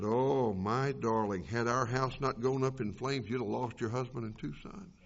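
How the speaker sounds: low, gentle reassurance to a distraught wife; tender comfort. Acted.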